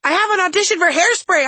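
high, loud, frantic shout; furious yell. Acted. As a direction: yell it at the top of your range. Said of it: "I yelled".

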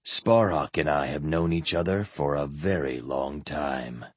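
low and resonant, unhurried; deep resonant voice. Acted. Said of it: "in his deep, resonant voice".

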